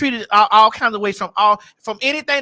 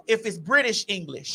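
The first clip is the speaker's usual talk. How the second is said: ordinary delivery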